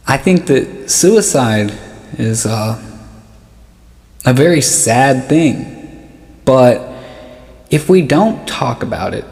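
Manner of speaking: normal talking voice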